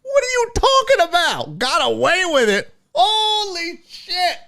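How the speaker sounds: hammed-up high disbelieving O J; incredulous O J. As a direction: play the outraged O J, squeaking. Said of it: "It was like".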